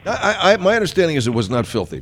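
usual speaking voice

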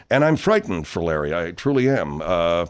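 low, soft, fake-solemn concern; mock concern. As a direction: play at being worried. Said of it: "Are you really, Mister Leonard?".

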